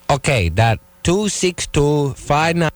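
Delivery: put-on slow, lightly accented Thai phone clerk; Thai order-taker's accent. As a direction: read digits back haltingly in a light Thai accent.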